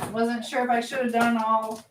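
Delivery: usual speaking voice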